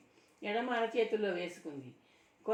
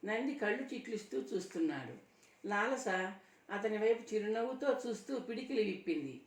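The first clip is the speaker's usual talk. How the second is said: ordinary delivery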